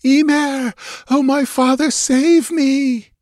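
high, ringing plea to a father; desperate cry. A narrator's reading. Girl flings her arms to the skies and cries out, desperate. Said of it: "and cried out".

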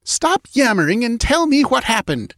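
high, impatient scolding; harsh cry. A narrator's reading. Queen scolds her panicking priest, crying harshly. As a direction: cut in with a high, harsh demand. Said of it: "she cried harshly".

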